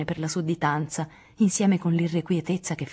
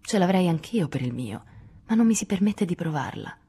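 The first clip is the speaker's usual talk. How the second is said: ordinary delivery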